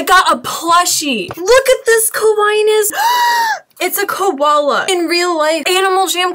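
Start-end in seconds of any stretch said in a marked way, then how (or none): 2.9-3.6 sharp high gasp of delight; excited gasp. Real, not acted. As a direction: gasp as if you can't believe it. Reacting to koala plushie.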